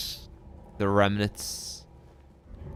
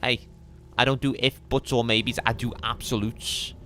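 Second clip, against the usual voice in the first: Scouse accent; 3 semitones higher, faster than usual. Shown as a demonstration. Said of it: "Scouse impression continuing".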